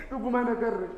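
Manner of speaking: soft, subdued repeat; quiet denial. Acted. Poet confirming the denial, hushed. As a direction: let the insistence fall away softly.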